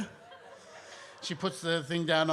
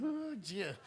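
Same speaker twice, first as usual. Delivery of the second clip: higher, softer fussing voice; concerned parents; 4 semitones higher, 7 dB quieter than usual. Retold or imitated. Parents reacting to their child's cramp, softly concerned in a higher voice. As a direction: imitate a parent cooing in concern. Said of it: "my parents were like".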